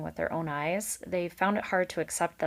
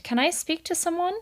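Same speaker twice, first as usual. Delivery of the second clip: high calling voice; 11 semitones higher than usual. A narrator's reading. Valerian calls across pond to beavers, high call. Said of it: "he cried out across the pond".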